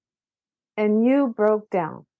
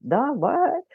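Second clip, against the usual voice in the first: imitated stunned reaction, swooping higher; mock stunned; 4 semitones higher than usual. Guest imitates people learning both pictures show one child, mock stunned. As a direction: act out a dumbfounded double take. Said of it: "it is mind-blowing".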